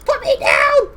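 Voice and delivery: very high, loud creature squeal; tiny grabbed creature shrieking; freaking-out ice mephit. Acted. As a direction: squeal it in a panic, far above your voice. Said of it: "will start freaking out".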